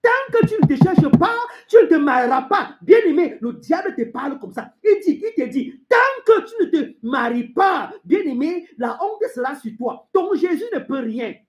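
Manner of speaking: high, driving sermon delivery; forceful preaching. Acted. Preacher warning the congregation with the devil's taunts, forceful. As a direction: press each warning home at a high pitch.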